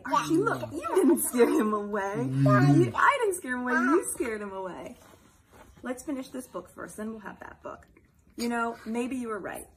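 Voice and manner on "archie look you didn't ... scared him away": laughing mock quarrel. Playful mock quarrel with child.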